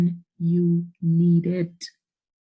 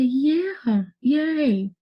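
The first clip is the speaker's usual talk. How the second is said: cheering yay; 5 semitones higher than usual. Retold or imitated. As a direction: cheer him on with a happy yay. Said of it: "I say".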